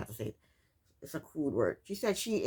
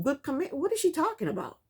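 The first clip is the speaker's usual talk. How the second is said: ordinary delivery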